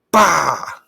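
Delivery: loud, high dismissive burst; scoffing. A narrator's reading. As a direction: scoff it away loudly. Humbug scoffs at the bee.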